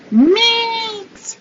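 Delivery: Meegs squeaked up high and stretched; squealed Meegs. Shown as a demonstration. Playfully squealing the new nickname Meegs high.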